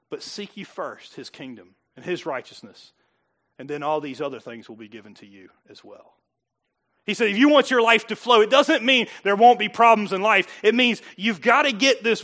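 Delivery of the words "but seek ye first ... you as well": reverent scripture. Retold or imitated.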